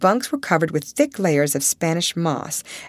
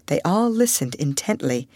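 ordinary delivery, nothing marked

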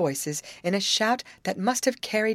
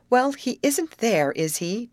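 slow, raised, pointed challenge; mildly demanding; 7 semitones higher, 4 dB louder, slower than usual. A narrator's reading. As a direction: demand an answer, lightly acted. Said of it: "demanded Molly".

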